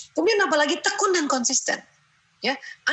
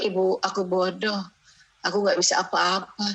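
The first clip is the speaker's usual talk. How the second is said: put-on sad child; 5 semitones lower than usual. Stressed child repeats complaint.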